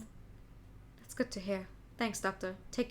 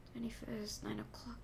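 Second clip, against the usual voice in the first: stunned mutter; 12 dB quieter than usual. Acted. Realizing he is late.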